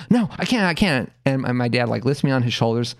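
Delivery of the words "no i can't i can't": high-pitched mock desperation; frantic kid. Boy answers parents in the crowd, frantic. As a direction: imitate a small boy missing the big moment.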